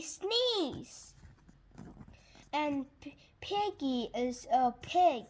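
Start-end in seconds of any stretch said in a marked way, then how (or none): none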